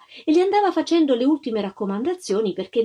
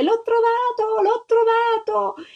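put-on high, slower child's cry of triumph; a little girl yelling her find, much higher and louder; overjoyed child shouting; 13 semitones higher, 3 dB louder, slower than usual. A narrator's reading. Little girl shouting she found the egg, high and overjoyed. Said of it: "si mise a gridare".

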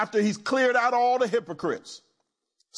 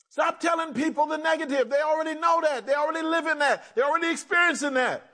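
high, driving preacher's cry; preaching at full force; 8 semitones higher, faster than usual. Real, not acted. Preacher urging the church to stop speaking negativity, driving and raised. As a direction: press it home to the congregation.

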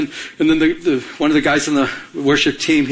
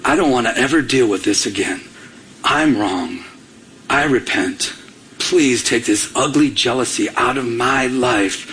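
broken repentance; 6 semitones lower than usual. Retold or imitated. Broken man asking God to forgive his jealousy, repentant. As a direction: retell a heartfelt confession, pitched well down. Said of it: "And it broke me".

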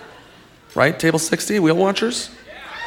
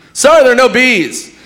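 host voice; 10 semitones higher, 5 dB louder than usual. Retold or imitated. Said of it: "Be like".

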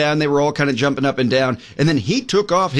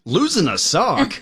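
incredulous; 4 semitones higher, slower than usual. Real, not acted.

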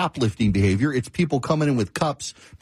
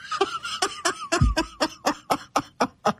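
high burst of real laughter; 3 dB quieter than usual. Real, not acted. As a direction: crack up at the AI's answer. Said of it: "I started laughing so hard".

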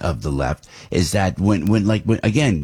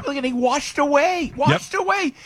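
worked up. Real, not acted. Outraged about flood victims.

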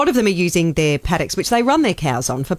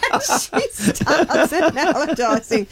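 laughing; 7 semitones higher, much slower than usual. Real, not acted. Laughing while telling funny story.

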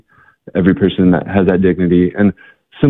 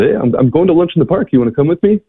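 higher, bright casual invite; friendly invitation; 10 semitones higher, faster than usual. Retold or imitated. John inviting Rhonda to lunch in the park, bright friendly offer. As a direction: lift your voice, easy and kind. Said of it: "And John said".

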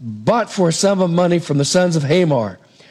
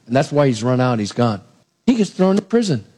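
ordinary talk, nothing marked

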